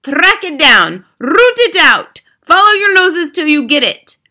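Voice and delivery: screamed orders, high and loud; Grand High Witch. A narrator's reading. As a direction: scream each command, drawing out the words. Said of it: "screamed the Grand High Witch".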